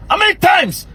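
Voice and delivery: high, shouted demand; furious repeated challenge; angry shout. Real, not acted. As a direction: yell the question at him. Scolding rant at northern critic.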